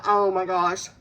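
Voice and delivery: ordinary delivery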